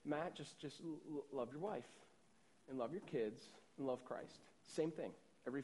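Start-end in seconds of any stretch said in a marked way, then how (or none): 0.1-4.4 grandfather's gentle charge. Retold or imitated. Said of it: "he always gives me this charge".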